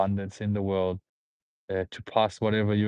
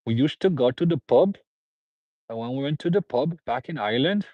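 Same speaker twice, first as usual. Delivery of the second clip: higher, swooping mock-Irish lilt; Irish accent; 5 semitones higher, 3 dB louder than usual. Streamer playing an Irish pub-goer on request, put-on Irish accent. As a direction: put on a rough Irish accent, tune rising and falling. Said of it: "I don't know if that's Irish".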